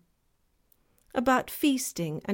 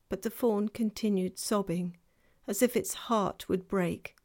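ordinary delivery

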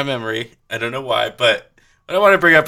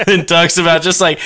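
usual speaking voice

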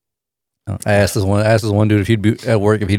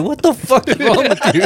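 shocked; 10 semitones higher than usual. Retold or imitated. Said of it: "he was like".

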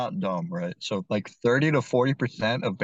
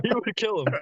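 laughing; 9 semitones higher than usual. Real, not acted.

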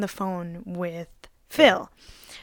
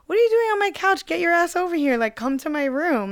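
high, quick, playful retold invitation; playing it cool; 9 semitones higher, 4 dB louder than usual. Guy on phone invites her to his room, playfully unbothered. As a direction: imitate a guy breezily summoning someone over. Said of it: "he played it so cool".

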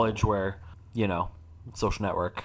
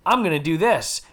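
mocking trend-follower; 7 semitones higher than usual. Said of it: "taken over by the trends of".

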